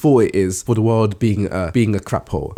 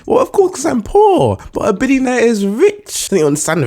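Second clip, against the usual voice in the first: much higher, mocking squeaky put-on; 11 semitones higher, 4 dB louder than usual. Mocking those who defend billionaires in a high silly voice. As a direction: squeak it like a smug know-it-all.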